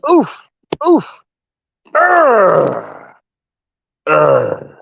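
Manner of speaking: high strained grunts from punching; effort grunts. Real, not acted.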